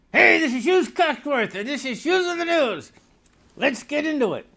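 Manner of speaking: bright high host voice introducing himself; cheesy news host opening the show; announcer intro. Acted.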